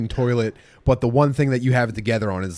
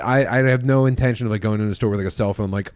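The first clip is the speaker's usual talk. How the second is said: ordinary talk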